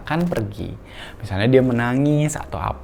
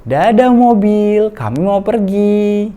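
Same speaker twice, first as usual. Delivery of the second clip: high, loud, very slow bye-bye to the car; sing-song goodbye; 10 semitones higher, 9 dB louder, much slower than usual. Shown as a demonstration. Parent saying goodbye to the toy car, high slow sing-song. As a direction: sing it out gently to a toddler.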